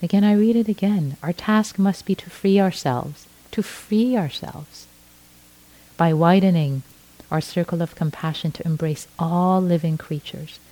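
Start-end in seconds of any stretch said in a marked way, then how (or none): none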